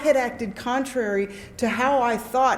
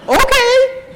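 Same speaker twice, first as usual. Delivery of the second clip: very high, loud squeal; gleeful agreement; 14 semitones higher, 8 dB louder than usual. Retold or imitated. Newcomer accepts sponsor's stripper suggestion, gleeful agreement. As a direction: squeal it with hammed-up eagerness.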